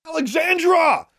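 high desperate call across distance; shout. A narrator's reading. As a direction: yell her name from far off. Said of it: "Brian shouted".

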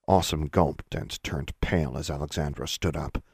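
ordinary delivery

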